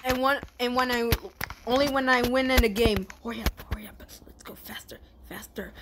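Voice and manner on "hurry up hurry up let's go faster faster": hushed urging; whisper. Real, not acted. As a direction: breathe the urging softly. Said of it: "Be quiet".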